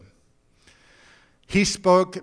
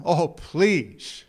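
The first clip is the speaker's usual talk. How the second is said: drawn-out whiny plea; begging. Acted. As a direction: really beg for it. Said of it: "One more time".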